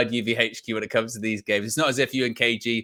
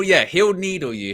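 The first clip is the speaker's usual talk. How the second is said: normal talking voice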